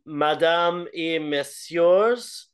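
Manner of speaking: slow French ring announcer call. Retold or imitated. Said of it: "fucking French intro".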